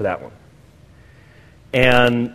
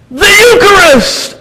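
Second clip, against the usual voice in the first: much higher, loud and drawn-out yell; 7 dB louder, much slower than usual. Retold or imitated. Audience member in line, shouting out a loud drawn yell. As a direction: blurt it out of nowhere at full voice. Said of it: "someone in line yells out".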